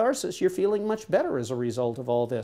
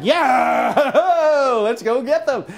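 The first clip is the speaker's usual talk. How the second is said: much higher, louder cheer breaking into laughter; mock rallying shout; 8 semitones higher, 8 dB louder, much slower than usual. Husband cheering along with supportive wife, mock shout. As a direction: whoop it up like you're ready to fight.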